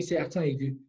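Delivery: usual speaking voice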